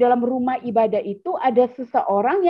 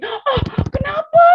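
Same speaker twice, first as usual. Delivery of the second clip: startled; 4 dB louder, slower than usual. Pretend. Startled reply to threat.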